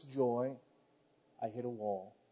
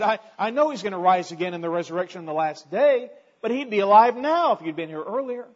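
loud, higher accusing tone; accusatory; 6 semitones higher, 12 dB louder than usual. Retold or imitated. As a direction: point the finger at him. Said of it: "She came out kind of accusatory".

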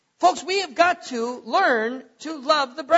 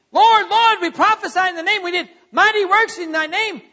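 loud, higher pleading "Lord, Lord"; 7 semitones higher, 8 dB louder than usual. Acted. Preacher voicing the lost before the judge, loud pleading. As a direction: beg like someone turned away.

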